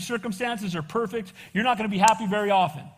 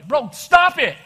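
sharp, loud and higher; loud no-nonsense call-out; firm loud rebuke; 5 semitones higher, 9 dB louder, slower than usual. Pretend. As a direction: bark it to stop him. Confronting a cheating friend.

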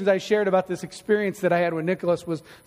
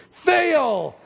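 emphatic cry; 6 semitones higher than usual. Real, not acted. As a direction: call it out like a buzzer. Preacher declaring the failure.

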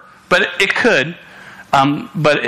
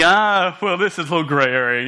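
mock waffling; 3 semitones higher than usual. Preacher imitating a boyfriend with weak boundaries, mock hedging. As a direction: imitate someone hedging, trailing off fast. Voices a wishy-washy boyfriend's excuse.

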